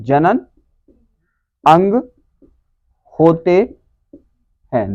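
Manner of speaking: halting, one word at a time; dictation. Shown as a demonstration. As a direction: leave long gaps for students to write. Teacher dictates corrected answer. (लिखवाते हुए, रुक-रुककर)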